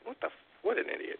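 whispered, half to himself; muttered aside. Real, not acted. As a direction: breathe it out under your breath in disbelief. Muttering disbelief at driver.

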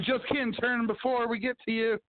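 a high, teasing mock voice for a careless driver; mocking other drivers. Mocking drivers who do not signal, in a high teasing voice. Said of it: "They're like".

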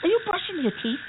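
can't believe what she's doing; exasperated disbelief. Acted. Betty brushing teeth mid-rescue.

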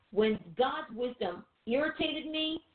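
usual speaking voice

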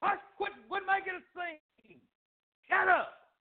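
high, harsh hushing; acted crowd telling someone off; ugly scolding. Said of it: "They were ugly".

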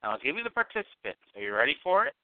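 ordinary talk, nothing marked

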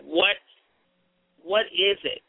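normal talking voice

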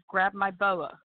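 normal talking voice

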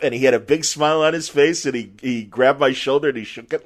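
normal talking voice

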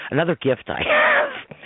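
laughing high through the words. Real, not acted. Laughs at own remark.

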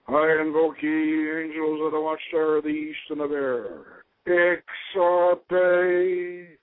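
grave ceremonial calling of the watchtower; ritual invocation. Real, not acted. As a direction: invoke the angels of the east with ceremony.

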